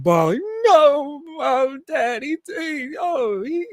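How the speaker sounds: high, slow mock-sobbing wail; imitating his crying. Fan reliving crying as a boy, mock sobbing. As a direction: replay a heartbroken kid in tears. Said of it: "I was crying when that happened".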